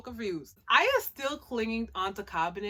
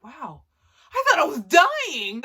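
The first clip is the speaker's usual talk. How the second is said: relieved exclaim; 6 semitones higher, 11 dB louder than usual. Retold or imitated. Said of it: "She's like".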